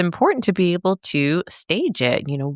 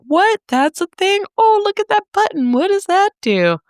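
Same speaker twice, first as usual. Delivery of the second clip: much higher, wide-eyed newcomer gawping; clueless wonder; 4 dB louder than usual. Retold or imitated. New clinician gawping at hospital gadgets, clueless wonder. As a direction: imitate your own dumb, high-pitched amazement. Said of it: "I'm out here looking dumb".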